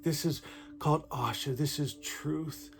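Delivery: softly awed murmur over holy words; hushed reverence. Real, not acted. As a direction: hold it close like a sacred secret. Hushed reverence after reciting scripture.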